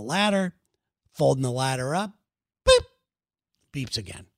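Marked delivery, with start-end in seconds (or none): 2.6-2.8 short sharp mock beep; imitated alarm beep. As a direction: chirp it like a smoke detector.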